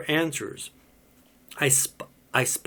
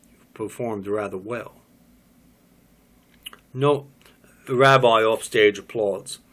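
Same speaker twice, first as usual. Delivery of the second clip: usual speaking voice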